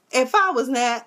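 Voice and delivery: ordinary talk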